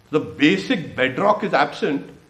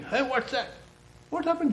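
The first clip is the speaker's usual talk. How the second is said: mock shouting; 12 semitones higher, 5 dB quieter than usual. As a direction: act out a sudden shout in a high voice, lightly. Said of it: "start shouting".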